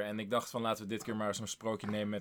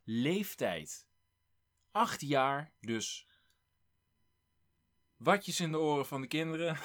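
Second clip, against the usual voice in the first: usual speaking voice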